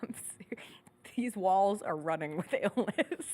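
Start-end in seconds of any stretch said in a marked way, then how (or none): 0.0-0.6 whispering. Real, not acted. Unvoiced aside.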